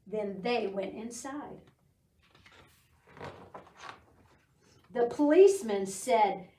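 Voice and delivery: bright storybook reading voice; teacher reading a rainy-day book to preschoolers, storybook voice; reading to children. Acted. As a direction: read it like story time for little kids.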